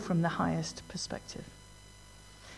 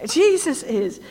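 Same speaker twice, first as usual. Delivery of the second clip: louder, higher ringing declaration; bold proclamation; 6 semitones higher, 7 dB louder, slower than usual. Acted. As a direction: proclaim it to the crowd. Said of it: "Some of you are getting into this".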